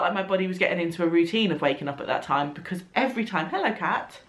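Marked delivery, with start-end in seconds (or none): none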